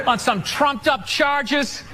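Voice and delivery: drawn-out punchline; slow deliberate. Acted. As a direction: lean on each word slowly.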